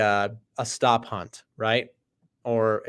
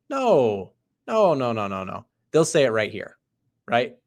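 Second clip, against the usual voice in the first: ordinary delivery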